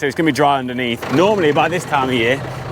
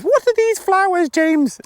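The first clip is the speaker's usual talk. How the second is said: much higher put-on Belgian accent, a jokey imitation; [name]'s Belgian accent; slower than usual. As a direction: mimic an old Belgian neighbour in a high voice. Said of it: "my best Belgium accent".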